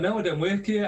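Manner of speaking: flat correction. Retold or imitated. Guy revealing he's no barman.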